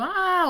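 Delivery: sudden high yelled ah; un grito agudo y fuerte; demo shout. Shown as a demonstration. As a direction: let out a short sharp yell to show shouting. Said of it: "si yo estoy gritando".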